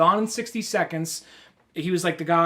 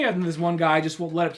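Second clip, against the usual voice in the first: ordinary delivery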